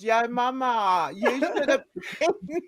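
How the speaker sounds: slow words through laughter; amused exclamation. Real, not acted. Telling yo mama about the fun missed, laughing slowly. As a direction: draw it out while still laughing.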